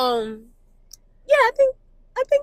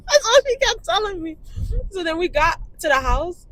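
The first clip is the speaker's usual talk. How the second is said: usual speaking voice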